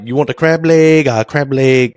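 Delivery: high, loud mock Chinese-accented English; imitated Chinese accent. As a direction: put on a Chinese buffet worker's accent. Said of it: "that's how he talked".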